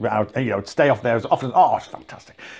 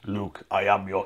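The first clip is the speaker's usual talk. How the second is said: much lower, softer deep Vader line; Darth Vader voice; 9 semitones lower, 11 dB quieter than usual. Retold or imitated. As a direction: sink into a low James Earl Jones rumble. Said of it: "James Earl Jones".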